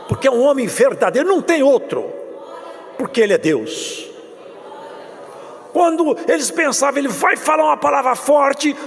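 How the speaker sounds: high, loud full-force preaching to the congregation; fiery preaching. Acted. Pastor preaching the suffering of Jesus, loud and high.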